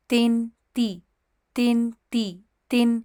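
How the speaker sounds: تن and تی set side by side, i vowel stressed; vowel contrast demo. Shown as a demonstration. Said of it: "اس کو تن نہیں پڑھیں گے".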